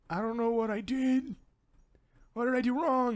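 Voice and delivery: high-pitched plea of innocence; hammed-up high whine of innocence; mock protest. Player protests to scolding caretaker.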